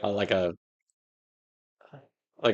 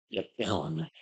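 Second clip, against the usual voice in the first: much softer, understated take; subdued catchphrase; 9 dB quieter than usual. Acted. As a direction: drop it down quiet, under the voice. Same catchphrase dropped right down.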